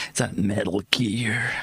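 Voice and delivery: husky whispered soldier voice; Snake impression. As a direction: whisper it like a stealth operative. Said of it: "my Snake impression".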